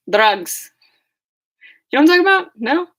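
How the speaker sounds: loud exclamation. Retold or imitated. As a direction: bark out Drugs like a punchline. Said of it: "a John Mulaney bit".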